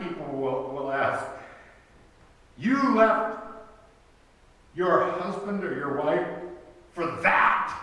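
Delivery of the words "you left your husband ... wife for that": high, loud disbelief landing on THAT; incredulous stress on THAT. Retold or imitated. People asking about a spouse who left, incredulous stress on THAT. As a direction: spit out THAT as a shocked question. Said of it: "it's not even a personal pronoun".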